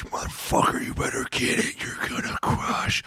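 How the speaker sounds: imitated coach's fierce whispered hype; intense coach whisper. Coach up close to lifter before bench test, intense whisper. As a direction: whisper hard, stretching the last words.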